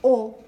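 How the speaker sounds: "O" as a rounded back vowel sound; full O vowel. Shown as a demonstration. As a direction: give "O" as an isolated, stressed vowel. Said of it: "instead of pronouncing it like an".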